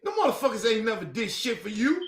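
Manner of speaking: ordinary talk, nothing marked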